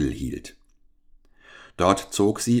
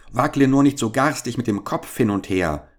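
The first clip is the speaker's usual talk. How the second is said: anxious cry; 6 semitones higher, 3 dB louder than usual. A narrator's reading. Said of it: "rief der Kleine ängstlich".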